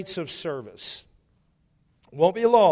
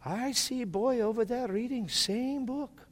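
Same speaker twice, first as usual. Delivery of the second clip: imitating old man; 7 semitones higher, 5 dB quieter than usual. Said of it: "He goes".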